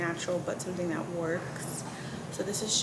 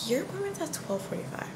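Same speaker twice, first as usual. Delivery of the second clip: receptionist voice; 7 semitones higher than usual. Retold or imitated. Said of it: "and she was like".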